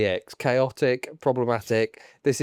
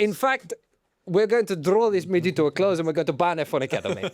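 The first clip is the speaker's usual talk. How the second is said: put-on comic Italian accent; Stefano Domenicali; 4 semitones higher than usual. Boss introducing himself to the public, Italian accent. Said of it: "As Italians".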